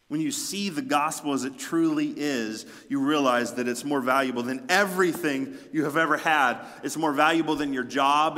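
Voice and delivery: forceful sermon climbing in pitch; impassioned preaching. Real, not acted. Preacher urging the church how valuable the gospel is, impassioned and building.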